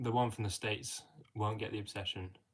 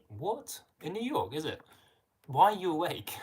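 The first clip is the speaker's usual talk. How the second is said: much higher, incredulous questioning; surprised disbelief; 7 semitones higher, 5 dB louder than usual. Real, not acted. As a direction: react in real disbelief, pitch jumping up. Reacting to viewer's late local time.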